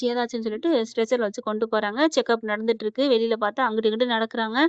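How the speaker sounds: plain reading voice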